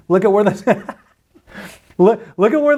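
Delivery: giggling through the words; laughing. Real, not acted. To viewers, pointing at the tiny supercluster while laughing. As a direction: laughs in awe mid-sentence.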